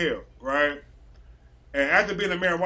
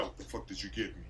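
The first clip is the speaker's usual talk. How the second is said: dazed coworker; 8 semitones lower, 13 dB quieter, faster than usual. Retold or imitated. Stoned coworker confronts boss.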